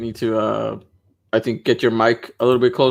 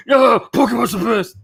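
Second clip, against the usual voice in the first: angry shout; 10 semitones higher than usual. Retold or imitated. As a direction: yell it like a furious fanboy. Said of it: "and then people are like".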